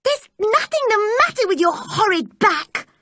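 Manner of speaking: high, cross scolding; angry cry. A narrator's reading. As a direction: tell him off furiously. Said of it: "cried Mary".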